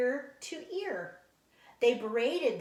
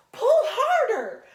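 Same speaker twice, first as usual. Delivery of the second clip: much higher, louder breathless cry; strained shout; 13 semitones higher, 13 dB louder, slower than usual. Acted. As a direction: squeeze it out with no breath left. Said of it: "breathing less".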